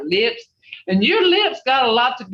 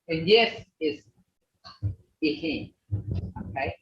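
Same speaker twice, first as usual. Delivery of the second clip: normal talking voice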